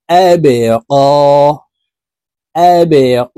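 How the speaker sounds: the ক in আবে ক drawn out one alif long; long vowel on ক, held one count; one alif stretch. Shown as a demonstration. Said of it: "কফকে একালিপ টেনে পড়তে হবে".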